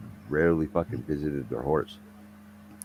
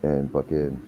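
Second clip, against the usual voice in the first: usual speaking voice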